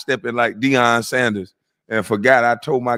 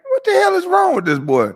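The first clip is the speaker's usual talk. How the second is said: incredulous. Real, not acted. Ranting at the streamer.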